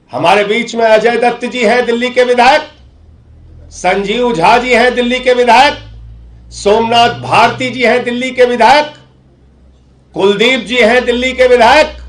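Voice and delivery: high, ringing list with rising ends; political roll call. Real, not acted. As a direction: announce each name high, lifting the last word.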